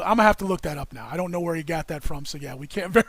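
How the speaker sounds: ordinary delivery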